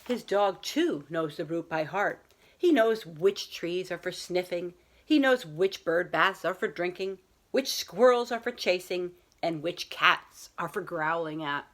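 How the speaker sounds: put-on children's picture book read aloud to a class; story time voice for young listeners; storybook reading. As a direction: read it like a teacher sharing a bedtime story. Teacher reads picture book aloud.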